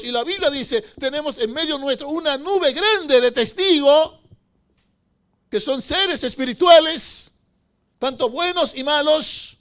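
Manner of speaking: loud, high, slow passionate preaching; preacher thundering at the congregation about focus in church; full-force preaching. Acted. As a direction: build it up and proclaim to the congregation.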